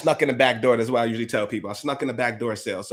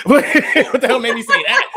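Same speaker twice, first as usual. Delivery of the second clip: laughing, much louder and higher; 11 semitones higher, 11 dB louder than usual. Real, not acted. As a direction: burst out laughing at yourself. Laughs at his own cocky intro.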